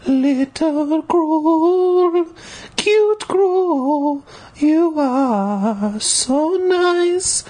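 high, slow adoring cooing. A narrator's reading. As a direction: coo to it like a baby. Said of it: "I cooed in its ear".

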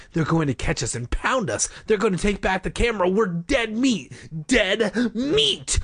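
high, urgent panic, building to a cry; rising panic. A narrator's reading. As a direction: let fear escalate as the pitch climbs. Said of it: "panting loudly now as he raced".

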